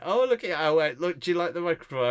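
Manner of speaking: put-on lightly sweet talk to a little child; gentle mock-cutesy coaxing of a child; cooing at a kid. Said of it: "and I went".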